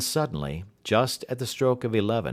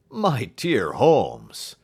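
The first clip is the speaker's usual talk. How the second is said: surprised; 2 semitones higher, 6 dB louder, much slower than usual. Acted. Friend astonished at deduction.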